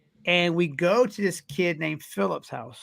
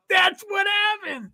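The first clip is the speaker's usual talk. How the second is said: much higher and slow, shouting it out; excited shout; slower than usual. Retold or imitated. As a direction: yell the confirmation, drawn out. Speaker confirms, matching friend's excitement.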